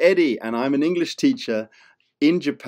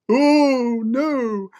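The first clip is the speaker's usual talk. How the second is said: much higher mock cry of alarm; 12 semitones higher, 5 dB louder than usual. Teacher pretending to panic at the grumpy monster.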